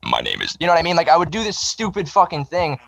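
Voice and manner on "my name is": very low, mechanical robot drone. Retold or imitated. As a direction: drop deep into a monotone robot voice. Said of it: "I walked around going like".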